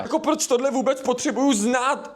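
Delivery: ordinary delivery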